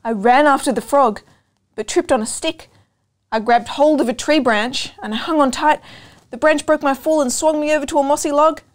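plain reading voice

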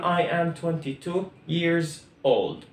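said very slowly, word by word; teacher modelling the full sentence; slow, clear classroom drill; slow model sentence. Shown as a demonstration. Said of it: "نكملها حتكون".

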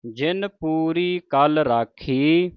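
very slow chanting of the line; slow Punjabi recitation. Shown as a demonstration. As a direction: draw each word out slowly. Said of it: "ਜੱਜੇ ਨੂੰ ਬਿਹਾਰੀ ਐੜਾ ਮੁਕਤ ਹੈ".

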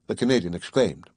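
usual speaking voice

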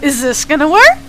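lightly imitated friend, rising question tone; mock questioning. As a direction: ask it like you doubt the machine. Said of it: "he kept on asking it like it was a question".

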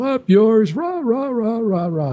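high-pitched mock crowd heckling; hammed-up high jeer, tailing into rah-rahs; jeering protesters. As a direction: imitate a rowdy mob shouting back. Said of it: "people, of course, are gonna go".

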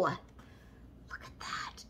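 awed slow whisper. Real, not acted. Vlogger admiring a gift, slow awed whisper. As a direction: breathe it out in wonder.

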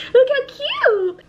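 very high, slow coo; adoring high-pitched squeal; cutesy squeal. Real, not acted. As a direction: squeak it and stretch the end. Squeals over the lipstick.

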